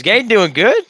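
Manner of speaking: high, loud taunting voices; sing-song mock taunt; mocking crowd. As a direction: jeer like the rest of the room.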